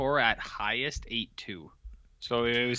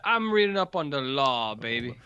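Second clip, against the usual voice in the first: Dusty Rhodes voice. Retold or imitated. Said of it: "now we got Dusty Rhodes here".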